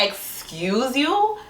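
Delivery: high, drawn-out offended question; indignant. Retold or imitated. Retelling her comeback to a rude stranger, indignant. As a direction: stretch it out in high-pitched offence.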